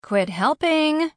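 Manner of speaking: high, loud, drawn-out call; exasperated sing-out to coworkers; calling back. A narrator's reading. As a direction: call back over your shoulder to meddlers. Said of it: "called back over her shoulder".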